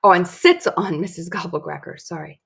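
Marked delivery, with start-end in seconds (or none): none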